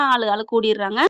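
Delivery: usual speaking voice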